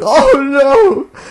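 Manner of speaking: high, loud cartoon cry of dismay; mock wail. Commentator voices naive Nick being overruled, loud mock wail.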